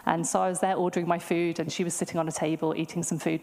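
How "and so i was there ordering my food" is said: ordinary talk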